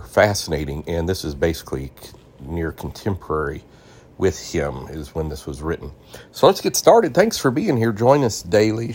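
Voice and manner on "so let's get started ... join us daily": high, loud welcome to listeners; upbeat show opener. Real, not acted. Host thanking listeners as the show starts, high and upbeat. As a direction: lift it up brightly to kick things off.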